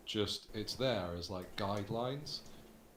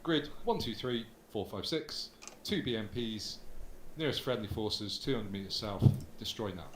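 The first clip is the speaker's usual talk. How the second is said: faster, brisk radio call; quick radio call for fire. Shown as a demonstration. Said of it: "you could probably speed it up a bit".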